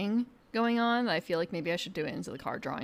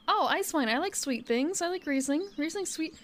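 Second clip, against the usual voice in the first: mock naive drinker; 8 semitones higher than usual. Mocking naive wine drinker.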